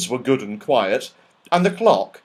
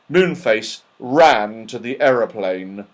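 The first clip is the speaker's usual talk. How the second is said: ordinary delivery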